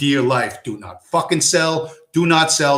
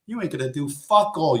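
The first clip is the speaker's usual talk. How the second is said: ordinary delivery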